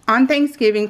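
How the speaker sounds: ordinary talk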